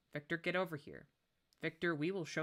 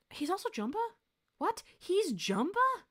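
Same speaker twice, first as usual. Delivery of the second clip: surprised; 5 dB louder than usual. Real, not acted. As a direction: ask "He's Jumba?" stunned, stretching it out. Disbelief at another role.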